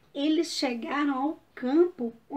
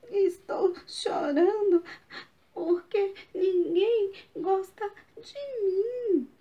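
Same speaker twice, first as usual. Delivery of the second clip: put-on tearful, higher child's voice; crying; 6 semitones higher than usual. Coquinho tells the fox why he cries, crying. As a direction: sob it out, sure nobody likes him.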